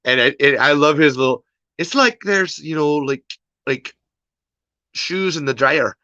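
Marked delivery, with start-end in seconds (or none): 1.8-6.0 badly faked Irish lilt; playful, hammed-up Irish accent; terrible Irish accent. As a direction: do a bad Irish impression. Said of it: "that was a terrible Irish accent".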